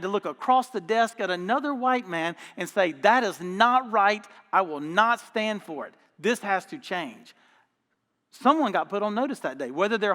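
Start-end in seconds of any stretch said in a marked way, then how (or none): 2.9-7.3 slow, weighty declaration of principle; retold firm stand. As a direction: lay it down word by word, slowly. Retold stand against racism across desk.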